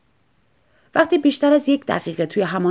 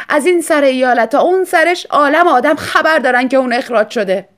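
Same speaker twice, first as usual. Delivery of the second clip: raised, louder voice, near tears; wife's loud retort; 6 semitones higher, 9 dB louder than usual. Acted. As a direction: throw it back at him loudly. Tearful wife retorts loudly. (فریاد زنِ آشفته)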